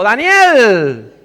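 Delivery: high, loud cry calling out for Daniel; king's desperate call into the pit; anguished shout. Acted. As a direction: cry the name out in anguish, drawing out the end. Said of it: "gritó a Daniel con voz angustiada".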